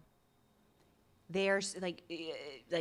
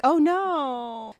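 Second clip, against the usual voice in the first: dismayed oh no; 5 semitones higher, 7 dB louder than usual. Retold or imitated. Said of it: "the lady's just like".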